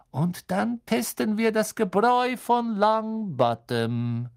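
erhobene, langsam gedehnte Lehrerstimme; gebieterischer Snape. A narrator's reading. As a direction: die Klasse genüsslich drohend anweisen. Snape weist die Klasse an. (herrisch und gedehnt)

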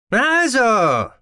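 high, loud, happy exclamation; delighted call. A narrator's reading. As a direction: call out gladly, pitch soaring. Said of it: "rief ein Begleiter erfreut". (erfreuter, lauter Ausruf)

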